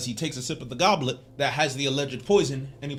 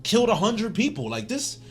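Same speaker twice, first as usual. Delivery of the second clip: higher, breezy brush-off of a friend's worry; casual dismissive buddy; 7 semitones higher than usual. Retold or imitated. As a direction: wave it off like it's nothing, dude. Guts brushing off Griffith's doubt.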